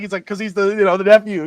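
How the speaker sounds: ordinary talk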